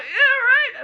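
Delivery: very high, laughing squeal; exasperated agreement. Retold or imitated. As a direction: agree in high-pitched, giggly disbelief. Retold agreeing with shocked brother.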